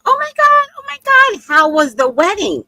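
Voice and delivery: high-pitched, loud squeal of delight; gushing, high-voiced eagerness; excited greeting. Eagerly greets the group about wedding.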